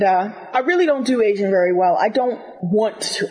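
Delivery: normal talking voice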